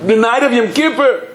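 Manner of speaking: high-pitched exclamation; dramatic high protest; acted outcry. Drinker protests over the holy night.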